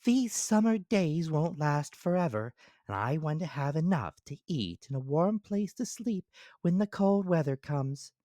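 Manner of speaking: high squeaky ant character. Acted. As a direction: voice the ant as small and sensible.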